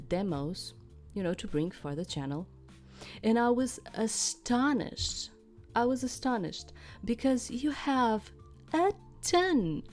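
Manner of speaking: normal talking voice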